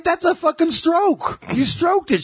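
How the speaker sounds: hammed-up irate comic ranting about his wife; Bill Burr rant. Retelling a Bill Burr bit in his high, angry voice. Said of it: "He talks like he's fucking pissed off".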